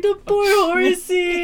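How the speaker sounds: mock crying kid. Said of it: "I was like".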